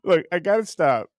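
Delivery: usual speaking voice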